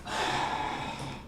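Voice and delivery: long, airy exasperated sigh; put-upon little girl's sigh; irritated sigh. Acted. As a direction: sigh out the annoyance. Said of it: "She seems more irritated".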